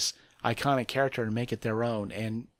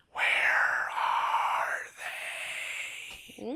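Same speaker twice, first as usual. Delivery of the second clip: Whisperer's whisper; 3 dB quieter, much slower than usual. Acted. Host imitating a Whisperer heard among the walkers' growls, drawn-out whisper. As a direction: whisper it eerily, stretching out every word. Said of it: "you can hear among the growls of the walkers".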